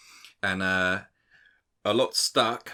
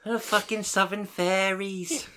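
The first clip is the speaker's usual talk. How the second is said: very high, slow Northern voice; hammed-up Northerner, pitched way up; Northern English accent; 12 semitones higher, slower than usual. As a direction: imitate Northerners, stretched and high. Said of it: "these Northerners talking to this".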